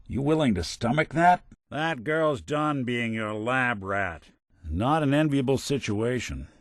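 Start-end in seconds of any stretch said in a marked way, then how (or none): none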